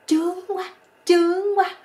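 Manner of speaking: loud, very high-pitched mock female cry; odd crying out. Young woman crying out in bed, a comically odd high cry. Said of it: "nó la lên một cái tiếng rất là kỳ cục".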